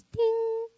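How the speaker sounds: high sung ding like a bell; bright mock chime; elevator ding. Elevator doors open.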